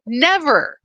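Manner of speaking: NEVER thrown out high and loud; a sharp, punched NEVER; emphatic never. Real, not acted. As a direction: hit "Never." hard and high, then drop. Emphatic denial after question.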